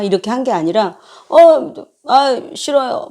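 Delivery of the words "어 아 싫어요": a drawn-out, whiny no; imitating a child who won't redo it; sulky refusal. Mom imitating her son's sulky refusal to reread, drawn-out and whiny. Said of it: "살짝 싫어하면서 안 하겠다".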